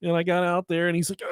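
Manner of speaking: usual speaking voice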